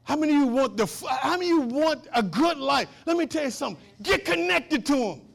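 loud, high exhortation; full-force preaching. Real, not acted. Preacher urging church to connect with God, exhorting. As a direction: call on the whole congregation to respond.

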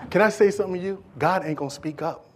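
ordinary delivery

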